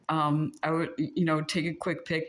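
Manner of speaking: ordinary delivery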